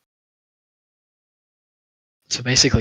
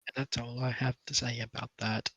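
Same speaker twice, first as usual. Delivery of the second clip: a hint of Forrest Gump, softer; half-hearted Forrest Gump, like a bad cartoon; Forrest Gump impression; 7 dB quieter than usual. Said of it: "a Forrest Gump impression".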